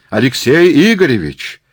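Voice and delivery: high, loud hail; calling out. Acted. Murat calls to academician across crowd, hollering. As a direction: holler the name over a crowd.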